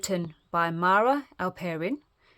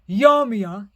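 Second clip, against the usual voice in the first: loud eager troll shout; loud drooling troll questions; 2 semitones higher, 9 dB louder, slower than usual. Acted. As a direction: shout greedily. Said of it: "he shouted".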